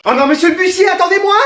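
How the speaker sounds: high, urgent call after someone. Acted. Late pupil to the bus driver, calling out desperately. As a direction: cry out to stop the driver.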